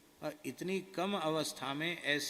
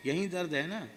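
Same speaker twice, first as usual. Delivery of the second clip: guru's question; 4 semitones higher, 6 dB louder than usual. Retold or imitated. Guru touches the sore spot.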